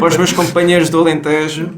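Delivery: loud, broad Alentejo greeting; Alentejo accent. Acted. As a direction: hail fellow Alentejanos in thick Alentejo accent. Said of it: "vai ser só sotaque alentejano".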